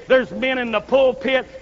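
ordinary talk, nothing marked

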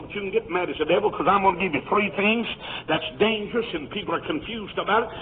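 normal talking voice

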